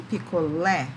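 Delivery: Picolé with the last syllable punched, pico-LÉ; stress on LÉ. Shown as a demonstration. Teacher answering which syllable is tonic, pico-LÉ with stressed ending. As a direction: hit the final LÉ of Picolé. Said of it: "Qual é a sílaba tônica mesmo?".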